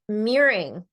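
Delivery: ordinary talk, nothing marked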